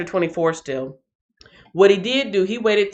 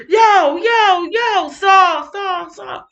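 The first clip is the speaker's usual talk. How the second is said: calling out; 11 semitones higher, 4 dB louder, much slower than usual. Retold or imitated. David calls out to Saul.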